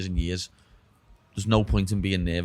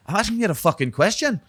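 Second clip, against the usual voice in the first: put-on high-pitched, exasperated Scottish accent; 10 semitones higher, 4 dB louder than usual. Retelling his Scottish friend's question, mock Scottish accent. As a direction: imitate an irritated Scot, pitched well up. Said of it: "how I have to do the Scottish accent".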